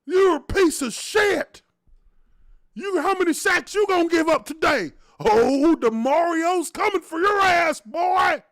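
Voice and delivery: high, loud, slow shouted numbers and insults; heckler yell. Retold or imitated. Imitating the fan berating the linemen in the back, loud mock shout. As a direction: yell down from the stands at the players. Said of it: "He's like".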